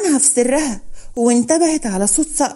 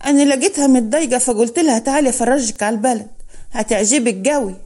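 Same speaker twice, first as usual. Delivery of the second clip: ordinary delivery, nothing marked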